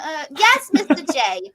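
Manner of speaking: lightly voiced Boston accent; a hint of Harley Quinn Boston accent. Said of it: "that was good".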